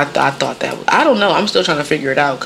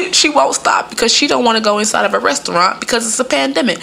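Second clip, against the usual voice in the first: ordinary talk, nothing marked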